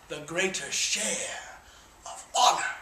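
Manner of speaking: whispered line of honor; hushed whisper. Acted. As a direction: breathe it to the troops in a hush. Hushed climax of speech.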